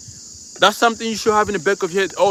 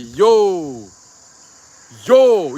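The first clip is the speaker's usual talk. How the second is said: calling out; 4 dB louder than usual. Retold or imitated. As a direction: call into the dark for the goats. Boy calling out in the dark.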